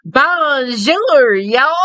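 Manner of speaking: high, loud mock-Texan French greeting. Imitating movie girl arriving in Texas, hammed-up Texan French. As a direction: ham up French with a Texas twang. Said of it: "to sound Texan and French".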